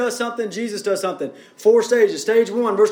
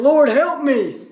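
raised, higher cry for help; pleading; 6 semitones higher, 4 dB louder, slower than usual. Acted. Woman worshiping Jesus begs for help, pleading. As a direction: plead upward, lightly acted. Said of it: "she came and worshiped him".

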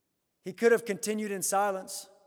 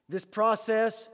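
normal talking voice

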